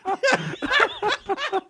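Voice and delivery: laughing through the words, slow and high; cracking up while admitting it. Real, not acted. Repeating the friend's jab, laughing.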